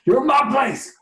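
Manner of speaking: high, angry shouted claim; shouting at trespasser. Acted. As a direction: yell to drive the intruder away. GM voices angry creature.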